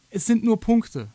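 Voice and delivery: slow, loud, sind hammered; stress on sind. Real, not acted. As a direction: lean hard on sind, slowly. Wiederholt die Pointe nachdrücklich. (Betonung auf sind, langsam und laut)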